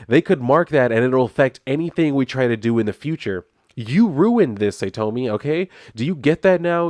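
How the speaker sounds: acted angry tirade. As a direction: act out an irate husband's dressing-down. Retold husband berating wife in quarrel.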